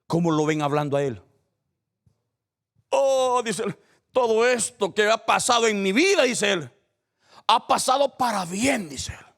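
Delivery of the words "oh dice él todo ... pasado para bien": José speaking. Acted. José reassures brothers, voiced.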